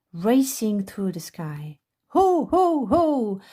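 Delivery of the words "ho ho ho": hearty high ho ho ho; a big jolly Santa laugh, pitched high; Santa's ho ho ho. A narrator's reading. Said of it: "laughed Santa".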